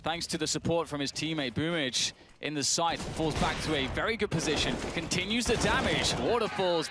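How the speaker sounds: live play-by-play. Acted. Caster calling the B-site retake live, excited play-by-play. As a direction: call the retake as it unfolds.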